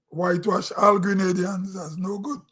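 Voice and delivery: ordinary talk, nothing marked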